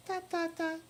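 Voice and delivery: high voice reading the ellipsis; silent pause read out. Pretend. Lydia answering with silence, read in her high voice. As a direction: say the dots as the girl's silence.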